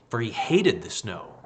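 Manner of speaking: usual speaking voice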